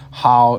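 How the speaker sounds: "how" said loud and open; "how" as an open ow vowel; pinyin how. Shown as a demonstration. Teacher modelling the pinyin "how" for learners. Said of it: "is said like".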